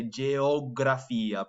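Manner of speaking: "geografia" slow, each vowel full; every vowel pronounced. Shown as a demonstration. Teacher modelling the subject word for students, slow. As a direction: give every vowel of "geografia" its own beat. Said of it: "pronouncing every vowel in there".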